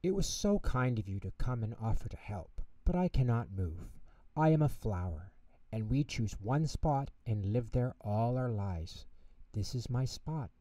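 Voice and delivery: flower gently refusing the penguin; soft voice. Acted. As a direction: speak softly and accept your fate. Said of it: "she said in a soft voice".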